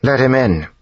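high, loud call from a tent; calling from inside. A narrator's reading. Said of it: "a voice called from inside".

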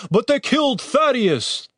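high, slow protest; half-hearted arguing. A narrator's reading. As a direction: protest without much conviction. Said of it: "didn't quite sound like his heart".

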